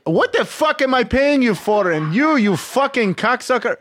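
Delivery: high, heated Israeli dad impression; abusive tough dad. Friend's dad berating a worker, mock angry rant. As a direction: imitate a hardcore Israeli boss berating staff. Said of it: "he was such a dick to everybody".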